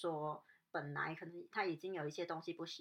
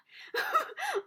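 shocked loud laugh; 8 dB louder than usual. Real, not acted. Laughs after the door slams.

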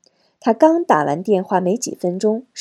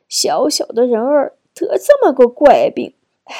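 higher-pitched lament ending in a sigh; tearful grandmother; 4 semitones higher, 4 dB louder than usual. A narrator's reading. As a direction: lament the child's illness as a grieving grandmother. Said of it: "擦了擦眼角的泪水". (奶奶心疼地哀叹)

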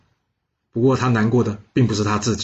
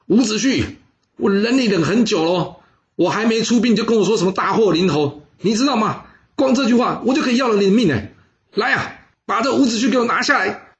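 higher, louder furious rebuke, rushing at the end; a king roaring for the guards; loud scolding king; 10 semitones higher than usual. Acted. King Fuchai berating Wu Zixu before arrest, furious high shout. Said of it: "他大声呵斥伍子胥说".

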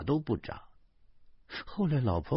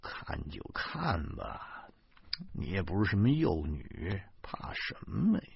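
groaning reply; 3 semitones lower, 6 dB quieter than usual. A narrator's reading. Husband in bed brushing off his wife, groaning. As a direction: groan it quietly, dismissing her worry. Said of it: "我哎呦了一声说".

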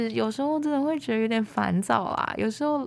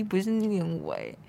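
ordinary delivery

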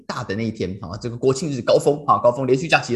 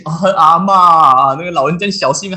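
exasperated sigh at grandma; 5 semitones higher, 9 dB louder than usual. Retold or imitated.

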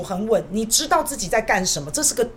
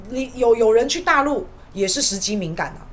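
ordinary delivery

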